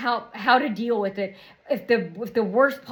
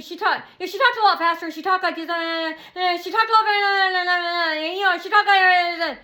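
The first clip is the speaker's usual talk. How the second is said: high, louder rushed jabber; imitating fast talker; 6 semitones higher, 4 dB louder than usual. Showing how she sounded on the phone, fast jabber. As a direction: gabble it in a raised voice.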